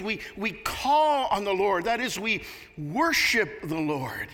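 full-force sermon delivery; preaching. Acted.

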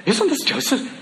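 high, delighted take on Isn't this Joseph?; thrilled local recognising him; excited. Shown as a demonstration. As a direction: say it beaming, with a laugh. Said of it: "that's how they're saying it".

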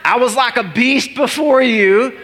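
high, slow, ringing declaration; stretched-out, exclaimed confession; impassioned prayerful preaching. Acted. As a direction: proclaim it to God with rising fervour. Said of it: "And I'm always asking God".

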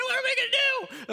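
very high, loud mock alarm; panicked students. Students losing it, retold with high loud panic. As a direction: wail it like it's a disaster.

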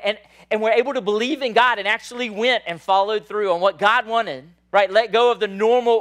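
loud, driving preaching; preaching at full force. Real, not acted. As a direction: push it hard to the back row. Sermon crescendo about shepherds.

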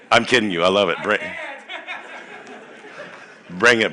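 ordinary delivery

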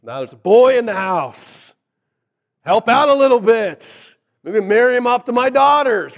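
loud, high, gleeful scheming; excited. Retold or imitated. Father thinking of a husband for his daughters, excited. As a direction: get loud and high at the prospect. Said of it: "He's excited for lots of reasons".

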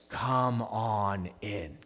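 soft, very slow, low welcome; tender invitation. Acted. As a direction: draw them in gently and slowly. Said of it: "And God says".

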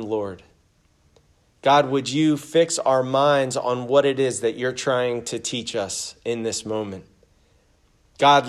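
prayer. Acted. Pastor praying over the congregation before the sermon, humble and hushed. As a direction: pray humbly, letting it sink to a hush.

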